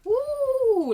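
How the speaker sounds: high, loud mock whoop of fake shock; mock woo. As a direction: whoop it up like the table is scandalized.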